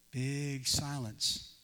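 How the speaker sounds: usual speaking voice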